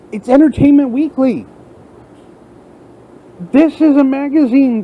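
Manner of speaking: real anger spilling over about the magazine; angry rant. Real, not acted. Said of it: "I'm angry".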